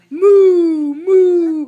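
loud drawn-out mooing; cow moo. Acted. Reading to a child, mooing like a cow. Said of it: "let's review".

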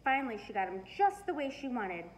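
plain reading voice

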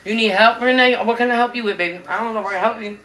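usual speaking voice

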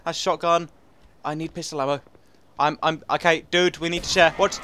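ordinary talk, nothing marked

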